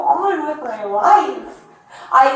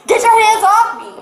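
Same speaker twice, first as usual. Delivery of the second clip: angry shout; 6 semitones higher, 4 dB louder than usual. Real, not acted. Arrest scuffle, repeated demand.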